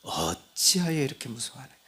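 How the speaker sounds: Jesus gently chiding the fearful disciples; gentle rebuke. Retold or imitated. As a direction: ask 어찌하여 softly, as a tender rebuke. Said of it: "그러면서".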